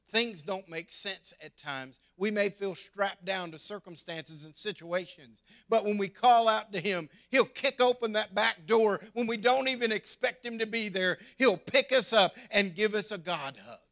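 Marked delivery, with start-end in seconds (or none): 5.7-13.8 loud, high, rising preaching; preaching at full force. Real, not acted. Pastor proclaiming God's rescue to the viewers, fervent. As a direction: build the promise with fervor.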